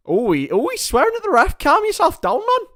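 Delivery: very high-pitched mock Geordie; Geordie accent. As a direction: put on a squeaky Geordie telling-off. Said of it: "Don't know why I went Geordie then".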